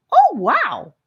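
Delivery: high, loud delighted exclaim. Acted. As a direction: burst out with pure delight. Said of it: "so delighted by the feeling".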